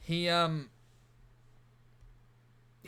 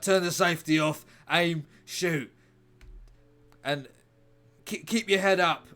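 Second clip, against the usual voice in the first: loud barked instructions, pitched up; shouting commands over gunfire; mock urgent orders; 5 semitones higher, 8 dB louder than usual. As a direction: yell the orders like a frantic mentor. Mentor coaching Dingo with pistol.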